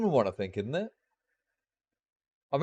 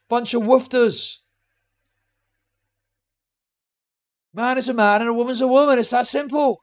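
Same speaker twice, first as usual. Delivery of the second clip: ranting Big Greg alter; 6 semitones higher, 5 dB louder than usual. Pretend. Streamer jokingly plays his own alter, a ranting pub bloke, pitched higher and louder. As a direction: play a ranting pub bigot, building to shouting. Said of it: "That was my alter".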